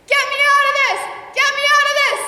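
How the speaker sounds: frantic yelling for help; panicked shout. Acted. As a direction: scream to be let out. Narrator trapped under bed, calling out.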